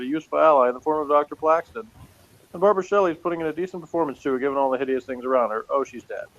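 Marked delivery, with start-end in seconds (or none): none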